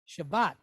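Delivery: "Shabbat" said with v, as sha-VAT; soft v sound. Shown as a demonstration. Teacher showing it without dagesh, "Shabbat" as Shavat. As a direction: replace the b in "Shabbat" with a v. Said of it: "if it didn't have the dagesh".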